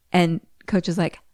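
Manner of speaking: ordinary delivery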